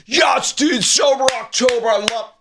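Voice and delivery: high-pitched dopey fan voice; mocking high fanboy imitation; stupid fan. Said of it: "all the fans are so stupid".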